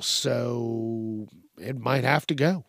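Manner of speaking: ordinary talk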